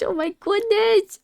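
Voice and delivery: high, slow hammed-up shock; mock scandalized. Streamer at spicy hand-holding before marriage, mock scandalized. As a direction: squeal it in pretend outrage.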